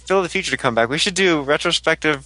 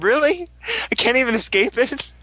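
incredulous disbelief; 7 semitones higher than usual. Retold or imitated. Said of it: "I literally said".

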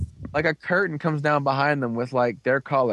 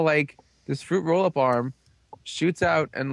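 normal talking voice

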